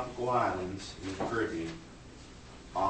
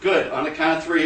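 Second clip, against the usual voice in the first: ordinary talk, nothing marked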